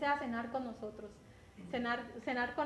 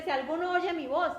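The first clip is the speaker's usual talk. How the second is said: "Si alguno oye mi voz" raised, higher and louder; emphatic quote; 4 semitones higher, 6 dB louder than usual. Shown as a demonstration. Preacher quoting the verse, emphatic and louder. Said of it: "Él habla suave".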